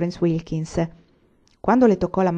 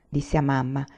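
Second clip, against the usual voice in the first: ordinary delivery, nothing marked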